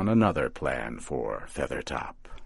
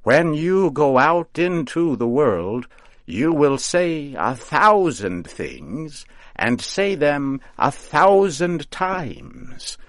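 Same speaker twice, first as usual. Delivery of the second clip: mocking witch; 9 semitones higher, 4 dB louder than usual. A narrator's reading. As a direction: teach him with sly mockery. Witch lecturing scarecrow.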